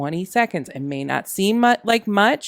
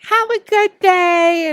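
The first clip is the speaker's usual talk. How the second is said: fake polite; 12 semitones higher, 6 dB louder, slower than usual. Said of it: "And I'm just like".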